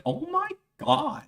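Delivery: put-on very high Valley Girl gasp. Valley Girl coworker exclaiming, pitched very high. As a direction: squeal it Valley Girl style. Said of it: "Like, totally".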